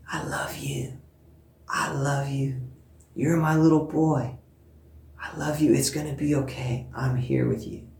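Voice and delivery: soft imitated toddler whisper to a doll. Mother imitating her son whispering to a baby doll, softly. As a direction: hush it like a child comforting a doll. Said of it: "whispering to this baby".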